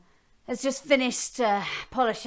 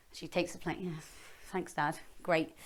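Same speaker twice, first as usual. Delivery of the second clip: ordinary delivery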